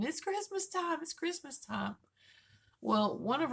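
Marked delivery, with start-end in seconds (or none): 0.0-2.0 high singsong mock shout, child-like glee; retold scream. Husband running the hallway at dawn, mock excited shouting. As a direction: call it out like an excited kid at dawn.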